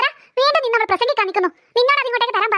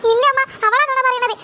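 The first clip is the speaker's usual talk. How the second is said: ordinary delivery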